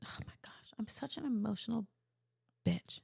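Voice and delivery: whispered, choked-up aside; emotional whisper. Real, not acted. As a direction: catch yourself tearing up, hushed. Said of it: "why I'm getting emotional".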